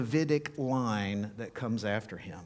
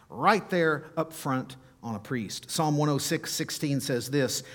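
ordinary talk

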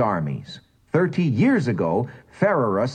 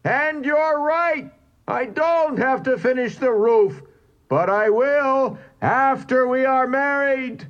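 much higher, determined roar; roaring louder. A narrator's reading. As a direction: refuse to leave, roaring it out. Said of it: "roared the louder".

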